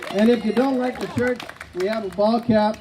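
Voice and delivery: brassy showman working the crowd; game show host. Acted. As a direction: sell the prizes like a TV host.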